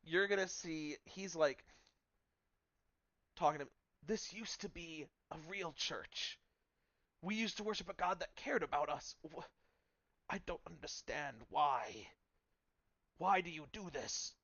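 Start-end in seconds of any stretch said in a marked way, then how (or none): 4.1-14.3 put-on pleading old uncle. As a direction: plead like a heartbroken elderly parishioner. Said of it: "And his niece is like".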